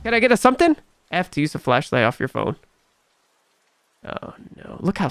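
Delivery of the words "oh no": whispered oh no. Real, not acted. Creeped out by the game.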